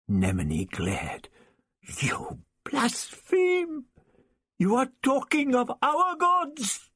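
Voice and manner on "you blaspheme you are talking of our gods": high, outraged cry at the blasphemy; storming rage. A narrator's reading. Nemini storms at the prisoners in high outrage. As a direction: hurl it as an accusation. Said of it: "he stormed".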